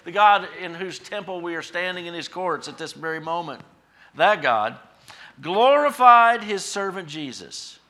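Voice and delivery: loud, high, driving proclamation; full-force preaching. Real, not acted. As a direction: hammer each phrase out to the congregation.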